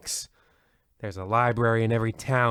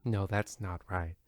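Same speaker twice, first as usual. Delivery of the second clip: muttered correction; 5 semitones lower, 11 dB quieter than usual. Acted. Host fumbling the title.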